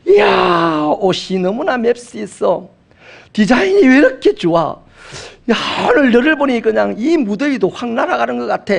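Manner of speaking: high, gushing friend's compliment; delighted reunion friend raving over an outfit; gushing praise. Retold or imitated. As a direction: pile on the praise like an excited old classmate.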